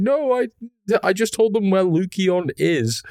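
a hint of a nervous gnome. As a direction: squeak out an excuse. Gnome answering Venger.

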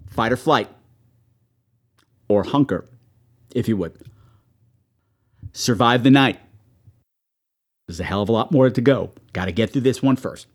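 low, measured delivery with pauses between commands; signature sign-off. Acted. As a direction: recite it like a solemn survival creed. Recites signature sign-off motto.

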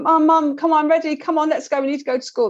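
put-on child pestering mum off the phone, impatient. Child voiced in a story.

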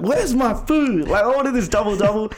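high-pitched mock rant; angry customer. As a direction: act out an irate customer complaining, pitch up. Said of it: "he was just really angry".

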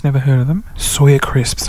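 ordinary talk, nothing marked

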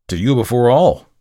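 loud, insistent answer; impassioned. A narrator's reading. Murderer answers the visitor's query, impassioned and loud. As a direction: press it on the listener.

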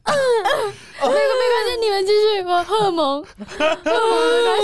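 high-pitched put-on tearful wail; hammed-up weepy reassurance; fake bawling. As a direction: pretend to sob while insisting you're fine. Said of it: "就痛哭吗".

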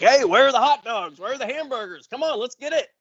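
mock rowdy old man calling for food; mock party old guy. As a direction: play the eager party guy. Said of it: "He's like".

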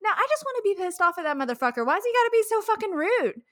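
high, loud, fast angry rant; ranting at a rude online hater, loud and fast; pissed off rant. Retold or imitated. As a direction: vent at the rude hater, fired up. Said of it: "I told Ryan, I was like".

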